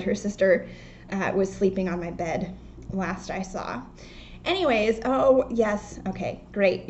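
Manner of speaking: normal talking voice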